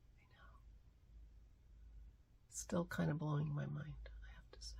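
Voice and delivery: soft whispered aside, overcome. Real, not acted. Vlogger whispering to viewers, moved. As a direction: breathe it out in a whisper.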